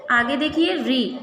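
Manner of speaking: normal talking voice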